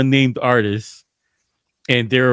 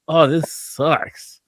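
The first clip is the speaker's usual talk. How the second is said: drawled disgust; much slower than usual. Retold or imitated. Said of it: "I'm like".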